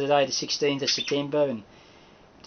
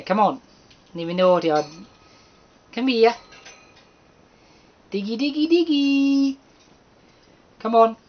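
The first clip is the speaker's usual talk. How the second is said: high sing-song calling to birds; coaxing pet birds; 9 semitones higher, 3 dB louder than usual. Real, not acted. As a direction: coax the birds in, high and sweet.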